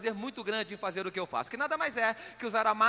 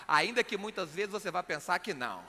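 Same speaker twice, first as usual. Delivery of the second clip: ordinary talk, nothing marked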